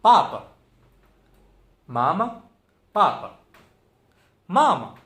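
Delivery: पापा and mama, each loud on the first syllable; पापा, मामा stressed up front, second syllable short. Shown as a demonstration. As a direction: drill पापा, मामा with punched openings and pauses between. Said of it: "ये ध्यान रखना है किस पे stress है".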